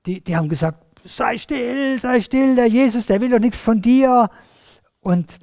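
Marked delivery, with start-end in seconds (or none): 1.0-4.3 higher-pitched, acted hushing; mildly scolding crowd. Crowd hushing the shouting blind man. (leicht genervtes Zurechtweisen, höhere Stimme)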